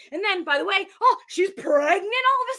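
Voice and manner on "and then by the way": usual speaking voice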